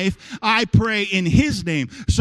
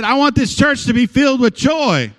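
higher and louder, "want" hit hard; loud declaration; 5 semitones higher, 6 dB louder than usual. Shown as a demonstration. Pastor showing the congregation how to declare it loudly. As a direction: proclaim "I want this church to be filled with joy" with fire. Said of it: "I said".

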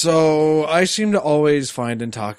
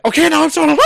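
sudden high loud fright; mock startled; 11 dB louder, faster than usual. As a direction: jump like scared. Acting out a jump scare.

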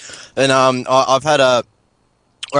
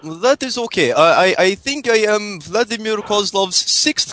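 fake Russian accent; 3 semitones higher than usual. Prank caller phoning hosts in fake Russian accent. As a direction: play a hammed-up Russian caller. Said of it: "was a good Russian accent".

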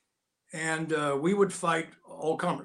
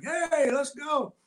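crowd cheering on; 11 semitones higher, 3 dB louder, much slower than usual. Retold or imitated. Crowd outside egging on fight.

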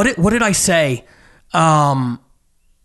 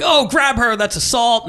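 high-pitched retold call to the bouncer; mock alarm, pitch jumping up; imitated alarmed callout; 6 semitones higher than usual. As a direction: act out a bartender calling for help. Bartender calls out to the bouncer.

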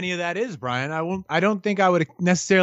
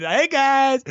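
higher, louder sing-song hey guys; a goofy vlogger hello; mock YouTuber hello; playful greeting voice; 8 semitones higher, 4 dB louder than usual. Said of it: "Hey Guy is really hard not to do that".

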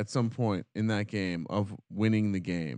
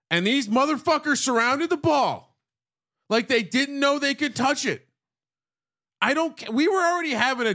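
much higher, louder incredulous rant; exasperated rant; 12 semitones higher, 3 dB louder than usual. Real, not acted. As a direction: rant in disbelief at the team. Ranting about coaching failures.

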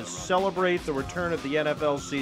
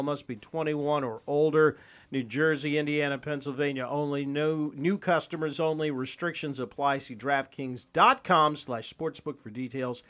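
rushed, quieter fine print; fast legal disclaimer; 6 dB quieter than usual. Acted. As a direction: rattle off the legal small print.

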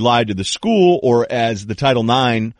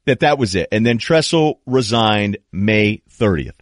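ordinary talk, nothing marked

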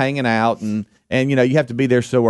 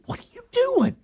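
incredulous; 5 dB quieter, faster than usual. Retold or imitated. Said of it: "and I said".

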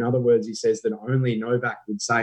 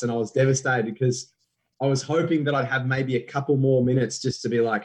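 ordinary talk

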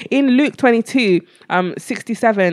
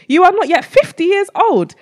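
incredulous; 6 semitones higher, 3 dB louder than usual. Acted.

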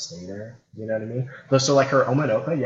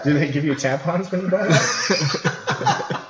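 laughing; 7 semitones higher, 5 dB louder, much slower than usual. Real, not acted. Joking about a beer brand.

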